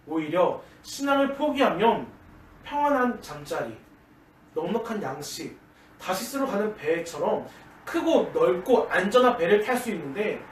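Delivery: slow, weighty sermon delivery; slow preaching. Acted. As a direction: draw each phrase out for the congregation.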